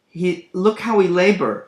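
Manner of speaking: "look" pushed up high and loud; stress on look. Shown as a demonstration. Lecturer mocking Gloucester's weary climb, stressing "look". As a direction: lean hard on "look how we labor". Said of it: "look" is just hilarious".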